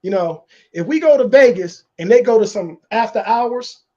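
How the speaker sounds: ordinary delivery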